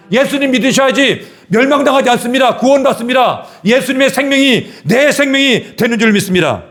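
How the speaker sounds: high, ringing pulpit proclamation; full-force preaching. Real, not acted. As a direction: preach it with rising fervor, pitched high.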